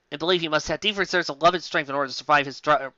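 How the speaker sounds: normal talking voice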